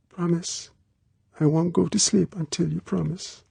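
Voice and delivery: put-on high, small, pleading girl's voice; insistent girl. A narrator's reading. Girl demanding Bond's promise before sleeping, small and insistent. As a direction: press him for it in a high little voice.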